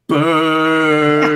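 a hammed-up loud boo; playful heckler boo; mock boo. Mock booing at a wrestler.

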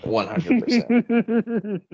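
slow, stretched laughing agreement. Real, not acted. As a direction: drag it out through a laugh.

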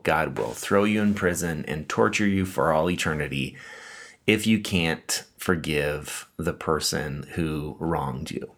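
ordinary talk, nothing marked

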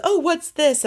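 high-pitched mock-innocent question; imitated coy surprise. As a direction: play coy, lifting the pitch right up. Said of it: "Jill very coyly says".

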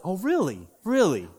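knowing, put-on disbelief; mock surprise. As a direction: act as if this is news to you. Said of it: "That's a big surprise to me".

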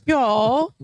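high "y'all" rising, in a mock British Isles accent; attempted English or Irish accent. Shown as a demonstration. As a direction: try "y'all" as an Irish or English speaker, lifting at the end. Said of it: "do it in an English or Irish accent".

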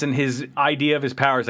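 normal talking voice

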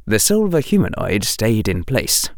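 ordinary delivery, nothing marked

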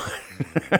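high laugh. Real, not acted. Disgusted laugh at toilet story.

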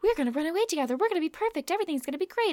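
playing Polly as a bit frantic and giddy. Recapping Polly planning to run away, mock giddy. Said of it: "Polly does come across a little bit frantic".

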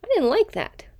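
a playful little squeak of complaint from the brain; voicing the brain. Coach voices the brain's mild objection to the snap. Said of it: "for your brain to think".

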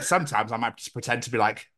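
usual speaking voice

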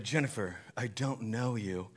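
hushed, measured mock judgment; Jesus. Jesus turning Jennifer away, low solemn imitation. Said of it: "Jesus is sitting there on the throne".

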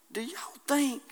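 hushed, breathy aside to the church; whisper. Acted. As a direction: lean in and whisper the question. Preacher drops to whisper.

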